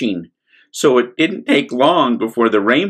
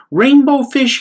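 high, eager call; little fish calling; 4 dB louder than usual. Acted. Little blue fish calling after Rainbow Fish, high and eager. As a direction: call after him hopefully. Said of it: "he called".

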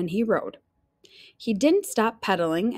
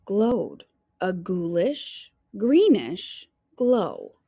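spooky narration; slower than usual. A narrator's reading. Spooky storybook phrase.

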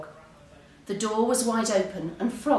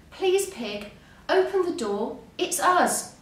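pleading call, climbing higher; urgent shout; 8 semitones higher than usual. A narrator's reading. Frog and Duck at Pig's door, urgent shout. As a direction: call out urgently, without a full yell. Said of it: "shouted Frog and Duck".

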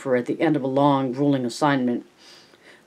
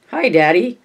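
high, loud greeting; child's bellow; 5 semitones higher, 6 dB louder than usual. A narrator's reading. Ellie greeting her father at the airport, bellowing. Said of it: "she bellowed".

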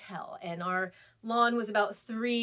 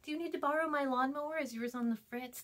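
higher-pitched, falsely kind neighbor; passive-aggressive kindness; 6 semitones higher, 4 dB quieter than usual. Retold or imitated. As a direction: imitate a neighbor hinting politely with veiled disapproval. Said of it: "kind of passive aggressive and kind about it".

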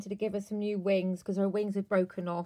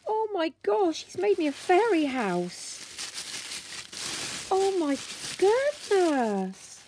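much higher and louder, bubbling with disbelief; overjoyed gushing; 7 semitones higher, 5 dB louder, slower than usual. Real, not acted. Thanking Geeta for the fairy house, high and thrilled.

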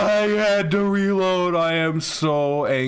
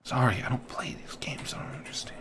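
apologetic unvoiced whisper; hushed confused apology; 6 semitones lower, 10 dB quieter, faster than usual. Real, not acted. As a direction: breathe it sheepishly. Hiding, confused about game.

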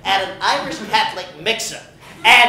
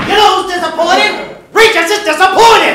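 building to a shout; 7 semitones higher, 6 dB louder than usual. Acted. Regis scolding the linebacker, shouting louder and higher. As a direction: start sharp, end yelling much higher and louder.

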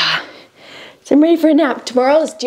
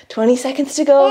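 normal talking voice

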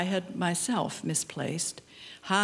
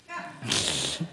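a short low chuckle; short laugh; 6 semitones lower, 4 dB quieter than usual. Real, not acted. Poet chuckling before a poem, short low laugh. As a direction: chuckle at the other people.

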